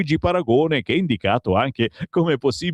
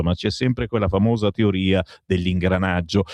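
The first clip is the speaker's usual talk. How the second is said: usual speaking voice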